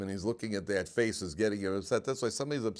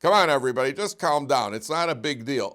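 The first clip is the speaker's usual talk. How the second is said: loud, higher plea for calm; peacemaker calling out; 5 semitones higher, 9 dB louder than usual. Retold or imitated. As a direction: shout over everyone to settle them.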